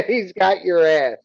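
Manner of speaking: high, loud line through laughter; laughing. Real, not acted. Game master gleefully tells player about the attacker, laughing. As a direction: crow it out, laughing.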